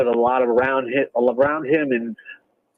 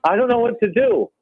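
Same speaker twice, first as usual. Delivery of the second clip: high, fretting fantasy owner; mock panic, pitched high; worried fan voice; 11 semitones higher than usual. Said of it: "People will look at Des Green and say".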